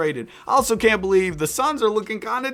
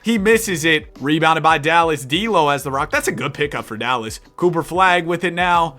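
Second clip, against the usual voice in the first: live sports commentary, rising with the action; energetic announcer call; play-by-play; 3 semitones higher, 5 dB louder than usual. Acted. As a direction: call the game like a broadcaster. Live play-by-play of game sim.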